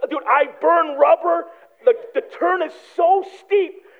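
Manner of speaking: normal talking voice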